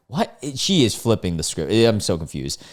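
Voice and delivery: ordinary talk, nothing marked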